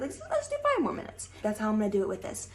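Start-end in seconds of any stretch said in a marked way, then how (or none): 0.0-1.3 very high, light self-encouragement; coaxing pep talk. Retold or imitated. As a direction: coax yourself sweetly. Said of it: "now, like".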